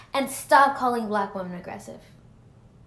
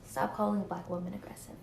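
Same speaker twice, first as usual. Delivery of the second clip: soft plea; 11 dB quieter than usual. Real, not acted. Final plea after repeated demand.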